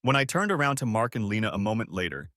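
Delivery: ordinary delivery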